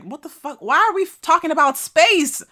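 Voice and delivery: high, loud disbelieving outburst; incredulous yell. Retold or imitated. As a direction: shout at the absurdity. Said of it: "I'd be like".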